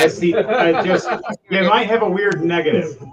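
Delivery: talking through laughter; laughing. Real, not acted. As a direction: let the laugh break into the words. Joking about the player's rolls.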